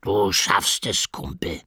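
slow, drawn-out creature voice; crocodile's murmur. A narrator's reading. Crocodile Rick encourages Silas before his test, slow murmur.

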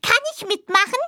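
high, bright curious small-animal voice; a tiny creature asking eagerly; little dormouse voice. Acted. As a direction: hazel mouse asking her bored friend. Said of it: "fragte die Haselmaus".

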